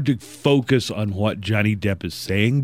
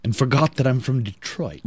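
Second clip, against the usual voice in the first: hammed-up clipped British accent; mock British accent breaking into a laugh; 4 semitones higher than usual. Host finishing a singer's excuse, mock British accent. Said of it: "speaking with a clipped British accent".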